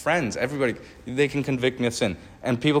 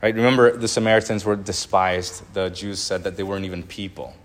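ordinary talk, nothing marked

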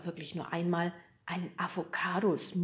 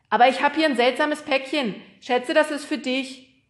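loud, high-pitched postman's voice; chatty postman; 7 semitones higher, 10 dB louder than usual. Acted. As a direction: speak up as a talkative postman.

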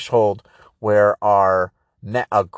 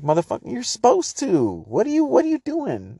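mock rant; 13 semitones higher than usual. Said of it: "It's like".

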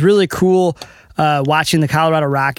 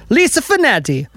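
high-pitched playful Italian flourish on the name; mock Italian; 8 semitones higher than usual. Host thanks the shop's manager, mock Italian flourish.

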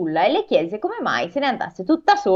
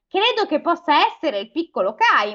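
crow character voice; 7 semitones higher than usual. Acted. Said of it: "disse la cornacchia".

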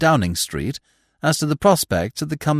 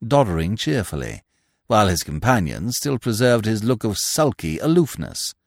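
ordinary delivery, nothing marked

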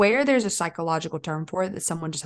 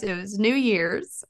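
normal talking voice

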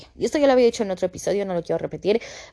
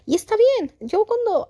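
normal talking voice

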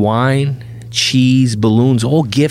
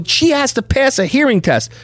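very high imitated objection; protesting nurse. Hospital staff stop father at the door, imitated high protest. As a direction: mimic flustered staff, pitched way up.

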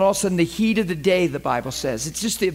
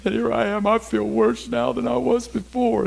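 higher-pitched mock grumbling; acted complaint; 6 semitones higher than usual. Preacher acting out a believer whose joy withered, complaining.

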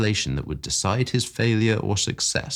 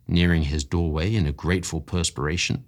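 plain reading voice

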